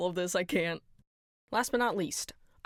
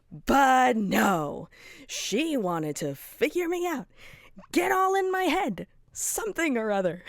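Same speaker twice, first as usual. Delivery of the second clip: loud, high, building to outbursts; bitter rant; 8 semitones higher, 5 dB louder, slower than usual. Acted. Resentful character ranting about Pomni shooting him, bitter and loud. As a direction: spit out the grievance louder each time.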